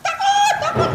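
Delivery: high, panicky cries of fear; panicked fear. Acted. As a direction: shriek in terror as the storm hits. Disciples panic in the storm. (para murid ketakutan di tengah badai, suara melengking panik)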